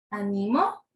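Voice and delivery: Ánimo said as a-NI-mo, ending on a rise; low Ánimo with the stress moved to the middle. Shown as a demonstration. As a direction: lean on the NI of Ánimo. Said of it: "Pueden escuchar la diferencia".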